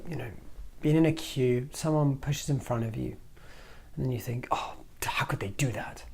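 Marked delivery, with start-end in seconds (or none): 4.5-6.0 hushed, resentful inner muttering; a low muttered complaint; 1 muttered grievance. Retold or imitated. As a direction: grumble it under your breath. Acted angry inner voice.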